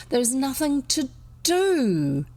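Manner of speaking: slow, high-pitched grumble; bitter. A narrator's reading. Boy complaining to his friend about Sunday, bitter. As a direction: drag out the boyish grievance. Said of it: "bitterly voicing unconsciously the grievance".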